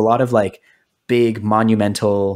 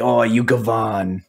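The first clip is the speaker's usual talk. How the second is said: mock Italian insult.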